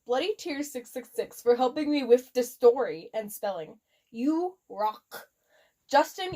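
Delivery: plain reading voice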